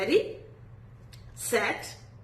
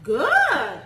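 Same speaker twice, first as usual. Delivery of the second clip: ordinary talk, nothing marked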